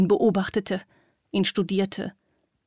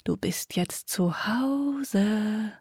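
very slow, unvoiced sinister croon; creepy whisper; 2 semitones higher, much slower than usual. A narrator's reading. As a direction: whisper it like a predator. Devil continues creepy voice.

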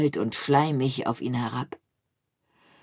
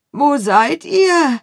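slow, much louder and higher, calling hollowly; 11 semitones higher, 10 dB louder, much slower than usual. A narrator's reading. Gruyère calling hollowly from the darkness for his friends. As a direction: call out into the dark, drawn out and hollow. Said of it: "rief Gruyère hohl aus der Dunkelheit".

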